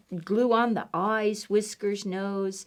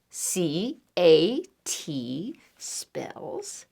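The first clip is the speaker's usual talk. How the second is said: slow spelled whisper; 4 semitones lower, much slower than usual. Shown as a demonstration. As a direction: whisper each letter slowly. Spelling slowly for children.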